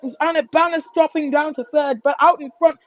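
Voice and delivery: normal talking voice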